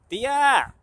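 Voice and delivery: high, loud, drawn-out call; shouting for an aunt. Retold or imitated. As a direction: holler across the yard for auntie. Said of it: "que le grita a su tía".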